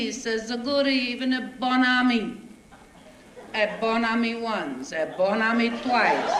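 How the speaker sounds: put-on broken English with a heavy Italian accent, comic storyteller; a thick Italian accent, told with a grin; Italian immigrant accent. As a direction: play the bewildered Italian newcomer telling his troubles. Stage comic in Italian immigrant dialect.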